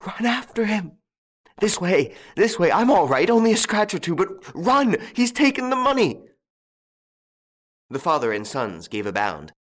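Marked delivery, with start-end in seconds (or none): 0.0-6.2 high-pitched frantic urging; frantic stammer. A narrator's reading. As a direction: cry out urgently, climbing to a high peak.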